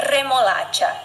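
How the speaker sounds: remolacha opening on a rolled R; strong trilled R. Shown as a demonstration. Teacher models the strong Spanish R for students to repeat. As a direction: trill the first R of remolacha hard. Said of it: "Primeiro o R forte".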